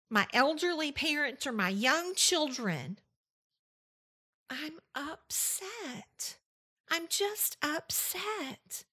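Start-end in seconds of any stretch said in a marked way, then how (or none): 4.5-8.8 soft, slow voicing of a listener's upset; voicing listeners' upset. Host voicing a listener's quiet upset, slow and soft. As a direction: say it softly, drawn out, as if hurt. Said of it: "You're telling me these things".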